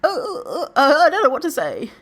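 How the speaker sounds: high, flustered, half-laughing. Retold or imitated. Caught off guard by a weight comment, flustered and high. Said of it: "you could just be like".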